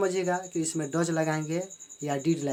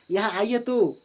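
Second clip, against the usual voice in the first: higher and louder urging; emphatic invitation; 4 semitones higher, 5 dB louder, slower than usual. Shown as a demonstration. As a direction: press them to come here. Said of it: "यानी जोर, जोर लगा कर बोलना चाहते हैं". (ज़ोर लगाकर ऊँची आवाज़ में बुलाना)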